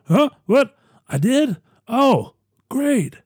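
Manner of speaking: lightly voiced confused old Biden; a dazed, slow-on-the-uptake Biden; Biden impression. Comic Biden hearing he won, a little bewildered. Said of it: "my Biden impression".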